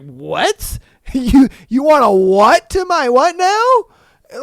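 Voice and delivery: hammed-up baffled stammer, rising high; mock incredulous. Girl answering a crude pickup line, in exaggerated disbelief.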